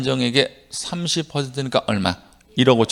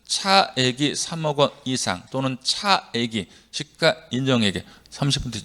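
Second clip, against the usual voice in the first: measured phrase-by-phrase reading. Shown as a demonstration. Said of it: "저는 이렇게 읽었지".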